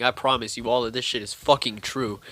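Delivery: normal talking voice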